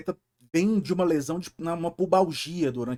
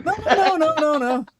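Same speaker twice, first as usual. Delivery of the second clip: high laughing "no no no"; laughing denial; 12 semitones higher, 7 dB louder than usual. Real, not acted. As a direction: protest through laughter.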